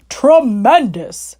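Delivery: slow, drawn-out delighted shout; mock shout. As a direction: Badger bellowing in glee. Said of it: "shouted Badger".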